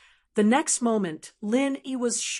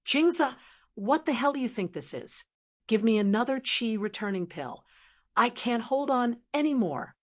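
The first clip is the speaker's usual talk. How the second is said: ordinary delivery, nothing marked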